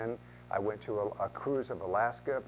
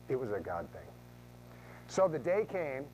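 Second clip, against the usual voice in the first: ordinary delivery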